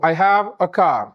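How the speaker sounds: very slow, each word laid out for learners; teacher's slow spoken example; slow model sentence. Shown as a demonstration. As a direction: model it slowly for the class to copy. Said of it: "एक ही बात को कहने के आपके पास तीन तरीके हैं".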